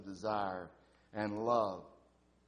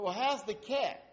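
higher voice, eager question; the wife's voice; 9 semitones higher, 4 dB louder than usual. Retold or imitated. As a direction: pitch up for the wife asking about her cat.

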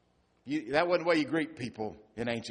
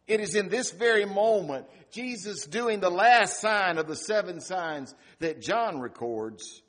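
louder, higher preacher's build; preaching at full force; 6 semitones higher, 7 dB louder than usual. Acted. Pastor preaching to the grieving congregation, full force. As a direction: drive the point home from the pulpit.

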